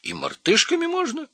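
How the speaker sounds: very high, squeaky question; excited little monkey voice; eager monkey. A narrator's reading. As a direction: squeak it hopefully, right up high.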